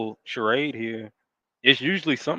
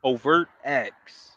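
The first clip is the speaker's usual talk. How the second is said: slow quote; much slower than usual. Retold or imitated. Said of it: "Monica says".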